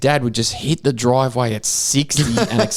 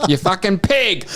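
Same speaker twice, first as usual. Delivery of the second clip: higher, angry retold shout at the boy; mock Italian dad yell; 7 semitones higher than usual. Storyteller imitates the Italian dad cursing his son. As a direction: spit it out like the furious old dad. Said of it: "and just go".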